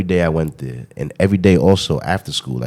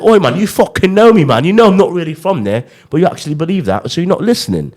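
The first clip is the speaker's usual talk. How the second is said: higher-pitched mock London accent, scolding friends; British accent; 9 semitones higher than usual. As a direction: fake British voice calling out old friends. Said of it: "believe I was from London".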